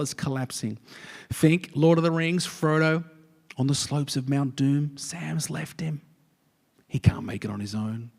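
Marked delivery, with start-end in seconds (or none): 5.0-8.1 hushed whisper. Acted. Dramatic aside about Frodo.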